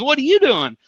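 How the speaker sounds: genuinely puzzled ask; surprised agent. Retold or imitated. Young agent asking him, mildly surprised. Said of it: "And he goes".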